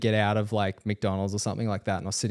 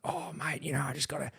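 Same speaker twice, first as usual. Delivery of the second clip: pleading stranger; 4 semitones higher than usual. Retold or imitated. Stranger begs him for a lift to court, imitated pleading. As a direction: wheedle like a dodgy bloke needing a favour. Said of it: "definitely a suspicious character instantly".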